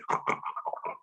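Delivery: breathy snort-laugh; imitating a friend snort laughing; snorting laugh imitation. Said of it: "like this".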